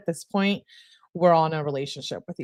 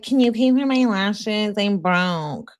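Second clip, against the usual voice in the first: higher-pitched mock pleading; mocking imitation; 3 semitones higher, slower than usual. Reader mimicking the girlfriend begging for lash money, mocking. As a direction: mimic a needy girlfriend, drawing out the end.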